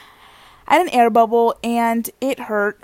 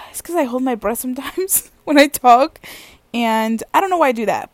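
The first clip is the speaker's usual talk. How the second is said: ordinary talk, nothing marked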